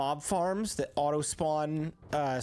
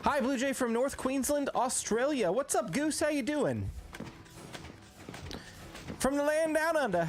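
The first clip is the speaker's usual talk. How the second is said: high-pitched, hammed-up Australian accent; bad Australian accent; 10 semitones higher than usual. Streamer greets a viewer from North Queensland, horrible fake Australian accent. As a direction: do a badly faked Aussie greeting, pitched well up. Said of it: "That was a horrible accent".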